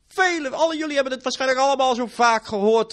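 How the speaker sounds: loud, high fiery sermon; full-force preaching. Acted. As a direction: thunder it from the pulpit.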